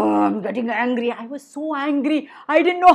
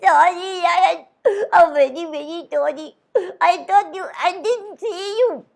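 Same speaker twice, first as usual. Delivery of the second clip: higher, pleading little mouse voice; timid mouse; 4 semitones higher than usual. Acted. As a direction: squeak out your apology to the big lion. Mouse voice in fable.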